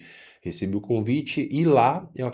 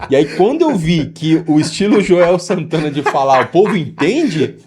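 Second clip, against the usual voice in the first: laughing; 5 semitones higher, 11 dB louder than usual. Real, not acted. Amused telling of Joel Santana style.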